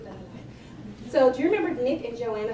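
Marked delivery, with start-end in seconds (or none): 0.0-1.1 slow, hushed aside; cheeky confession. Real, not acted. Speaker admits it's not the last story, hushed. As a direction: drop to a near whisper, drawn out.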